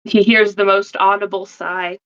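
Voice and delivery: ordinary talk, nothing marked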